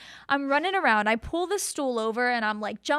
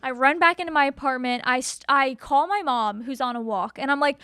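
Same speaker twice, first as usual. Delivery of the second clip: ordinary delivery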